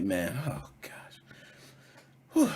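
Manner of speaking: ordinary delivery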